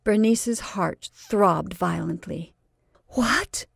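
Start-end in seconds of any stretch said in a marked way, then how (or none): 3.1-3.7 gasp. Acted. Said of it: "she gasped".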